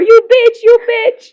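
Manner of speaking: high, loud mock screaming caller; angry caller imitation. Mother's spam caller screaming back at her, hammed-up shriek. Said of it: "He kept saying over and over".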